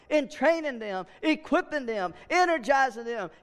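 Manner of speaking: ordinary delivery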